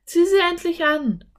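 put-on girl voice, keen to go in; eager Anne. A narrator's reading. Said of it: "Anne lachte".

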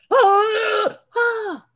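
high, loud manic dad swinging to delight; bipolar dad. Retold or imitated. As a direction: play the dad flipping from anger to glee. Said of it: "The bipolar dad comes out".

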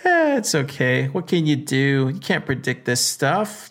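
ordinary talk, nothing marked

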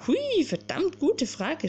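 slow, high astonished "Hui"; amazed. Acted. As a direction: marvel at it, drawn out. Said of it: "staunte Alka".

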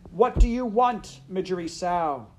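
high, loud shouted question; shouting. A narrator's reading. Princess repeating her question to the maid, shouted. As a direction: call it out to someone who can't hear. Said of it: "the princess shouted".

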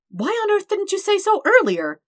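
high, loud incredulous cry; incredulous outburst. Acted. Dirk hearing Todd loves him, high incredulous cry. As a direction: throw it up in disbelief.

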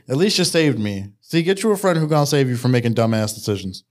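ordinary delivery

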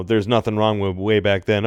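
normal talking voice

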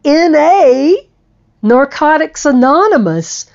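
high and loud, beaming; joyful high yes; excited confirmation. Retold or imitated. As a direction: proudly confirm it. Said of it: "He goes".

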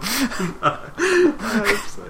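high, slow, laughing through the words; giggling agreement, drawn out. Real, not acted. To her co-host about the German Bond title, laughing.

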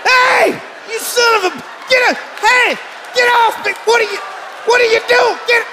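yelling high and loud; panicked shout. Acted. Yelling at doctor behind him.

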